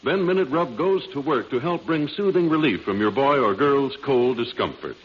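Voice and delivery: ordinary delivery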